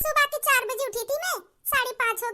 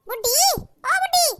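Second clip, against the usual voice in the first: louder wake-up shout; 5 semitones higher, 5 dB louder than usual. Acted. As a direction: yell her awake with rising impatience. Calls louder to wake her.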